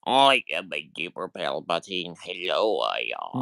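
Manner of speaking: low, droning droid voice; robot voice. Retold or imitated. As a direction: speak like a clunky droid. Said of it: "Now you sound like one of the robots".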